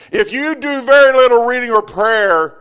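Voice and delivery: loud, high preaching; sermon at full force; fiery preaching. Acted. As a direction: press the congregation hard.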